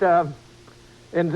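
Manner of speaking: ordinary talk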